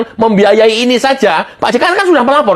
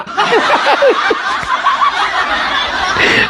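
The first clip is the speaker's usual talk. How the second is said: high, airy burst of laughter; 3 dB quieter than usual. Real, not acted. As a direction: laugh at how absurd it is. Laughing after Enggak, Pak. (tertawa geli)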